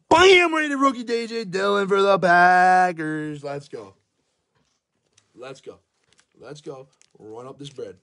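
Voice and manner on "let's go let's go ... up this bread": muttered hype. Real, not acted. Muttering to himself.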